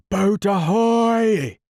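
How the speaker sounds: high, slow, loud captain's shout; shouted hail. Acted. Hook shouting to his men from the water. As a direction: bellow across the lagoon. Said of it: "again came the voice".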